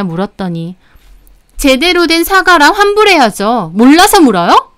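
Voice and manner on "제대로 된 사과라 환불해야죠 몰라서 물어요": high, loud indignant retort; angry demand. Acted. Customer demanding apology and refund from the owner, angry. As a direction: demand it with a sharply rising question.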